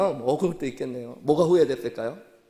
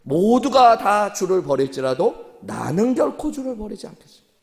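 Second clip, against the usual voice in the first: bold vow; 7 semitones higher, 7 dB louder than usual. Retold or imitated. Peter boasting to Jesus he will never desert him, loud and proud. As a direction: swear it proudly, every word weighed. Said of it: "베드로가 혼자 이렇게 얘기했어요".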